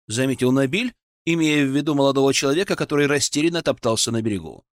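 plain reading voice

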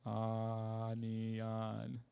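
"Onion" stretched as ahhh-neee-ahn; slow monotone misreading of "Onion"; sounding it out slowly. Retold or imitated. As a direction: draw out every syllable of "Onion", still getting it wrong. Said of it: "Sound it out again, slowly".

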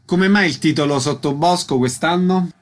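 ordinary delivery

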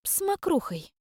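высоким чужим голосом, неуверенно запинаясь; замяться, отвечая тонким голоском; замявшись. Acted. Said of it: "замялась Кикимора". (Кикимора мнётся, отвечая хозяйке тонким голосом)

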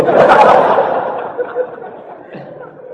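shrill unvoiced mother's laugh; high mock laugh. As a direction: burst out laughing in the lady's voice.

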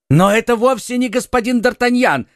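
high, shocked outcry; crying out in disbelief, with the voice jumping up. A narrator's reading. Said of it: "вскричал Бонасье". (высоким голосом вскрикнув)

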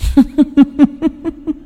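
high-pitched staccato evil giggle; creepy high cackle. A narrator's reading. Possessed boy mocks the shaman, eerie high cackle. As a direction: giggle like a taunting spirit.

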